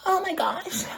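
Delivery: very high, whimpering fake ecstasy; hammed-up breathy climax demo; mock orgasm. Shown as a demonstration. Showing Crystal how you do it, mock-ecstatic whimper. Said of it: "This is how you do it".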